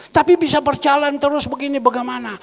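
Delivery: loud, high, urgent query; insistent questioning. Retold or imitated. Retold man presses pastor about funding.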